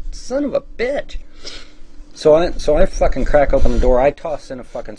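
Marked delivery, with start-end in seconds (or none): 0.1-1.2 exasperated curse. Retold or imitated. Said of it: "and I'm like".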